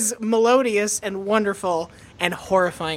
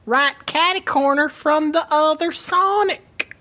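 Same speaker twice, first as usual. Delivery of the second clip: drawn-out, hammed-up Southern whine; whiny Southern accent; 5 semitones higher than usual. Mock local griping about the shopping center, whiny Southern accent. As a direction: complain in a mock Southern drawl, rising high. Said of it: "that specific brand of Southern accent".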